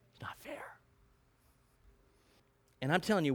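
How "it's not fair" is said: breathy sulky whisper; whispered it's not fair; whispered complaint. Acted. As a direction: hiss it like a sulking child. Grumbling workers' complaint.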